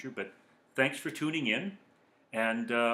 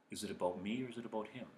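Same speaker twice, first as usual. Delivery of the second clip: quick muttered mantra; 3 semitones lower, 8 dB quieter, faster than usual. Shown as a demonstration. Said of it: "mantra demo".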